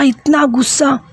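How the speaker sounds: usual speaking voice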